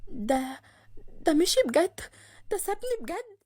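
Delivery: stunned, faltering and high-pitched; disbelieving. A narrator's reading. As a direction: let it sink in, voice rising in disbelief. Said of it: "وهي مش مصدقة اللي حصل". (مش مصدقة ومصدومة)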